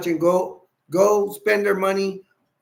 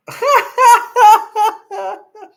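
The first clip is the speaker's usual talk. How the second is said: burst of laughter; 10 dB louder than usual. Real, not acted. Laughs at chat's joke.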